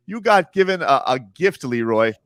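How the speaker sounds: ordinary delivery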